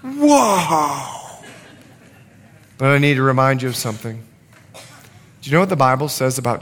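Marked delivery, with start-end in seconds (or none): 0.0-1.4 long amazed wow, higher at the start and falling; stunned, drawn-out exclamation; astonished. Acted. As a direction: marvel with a stretched-out wow. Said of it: "they were astonished".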